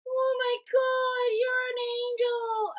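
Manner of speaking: very high, drawn-out wonder; imitated tripping girl's high coo; awestruck roommate. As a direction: stretch it out in dazed awe.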